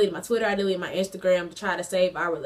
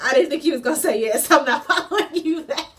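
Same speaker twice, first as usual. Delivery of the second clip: high, loud quote breaking into laughter; cracking up while imitating him; retelling, amused; 6 semitones higher, 5 dB louder than usual. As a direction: laugh through the ex's comeback. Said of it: "That was so funny to me".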